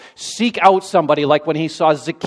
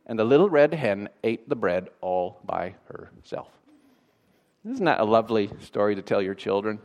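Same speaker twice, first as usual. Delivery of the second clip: lower, softer mock-sweet storyteller; deadpan irony; 7 semitones lower, 6 dB quieter than usual. Real, not acted. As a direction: drop down and deliver it drily. Ironic comment after shouted story ending.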